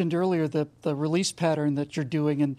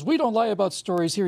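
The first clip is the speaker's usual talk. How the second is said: ordinary talk, nothing marked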